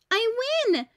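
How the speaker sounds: put-on a child's high, loud shout of victory; triumphant shout. A narrator's reading. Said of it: "Eric shouted".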